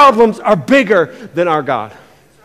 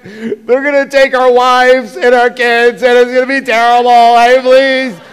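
mock whimpering plea; 2 semitones higher, 4 dB louder than usual. Preacher imitating the curled-up spies, mock frightened begging. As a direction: play the spies pleading in terror, hammed up. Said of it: "They're like".

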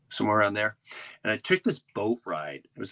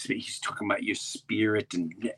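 normal talking voice

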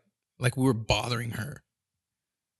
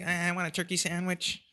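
put-on higher, whiny kid voice; 5 semitones higher, 6 dB quieter than usual. His son asking for breakfast, whiny and high. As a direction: beg like a little kid. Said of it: "they're like".

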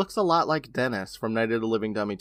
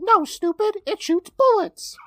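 high, squeaky puppet voice, slow at the end; a cheeky ventriloquist dummy; 6 dB louder, slower than usual. Acted. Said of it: "And the dummy's all".

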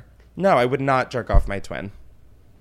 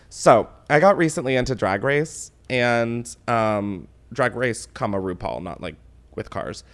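normal talking voice

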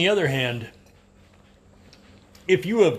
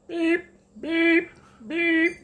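high squeaky imitated car horn; wheezy car horn beeps; much slower than usual. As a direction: beep like a feeble little car horn. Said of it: "a little wheezy old man car".

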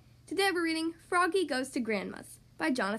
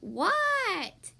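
calling back; 5 semitones higher than usual. A narrator's reading. Froggy answers Grandma's call.

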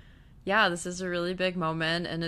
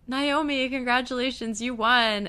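higher-pitched announcer imitation; mock congratulations; 6 semitones higher than usual. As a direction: announce the winner in a put-on higher voice. Acts out the election announcement.

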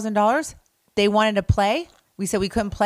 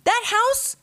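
much higher pitched, indignant outburst; worked up about the crack den; 12 semitones higher than usual. Real, not acted. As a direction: voice shoots up in disbelief. Outraged about the old house.

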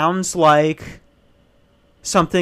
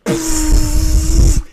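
engine roar imitation; 12 semitones higher, 6 dB louder than usual. Imitating loud engine noise.

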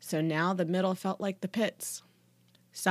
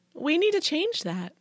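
high-pitched flirtatious coo; 10 semitones higher than usual. A narrator's reading. Cheerleader works on teacher, cooing. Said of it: "cooed Trudy".

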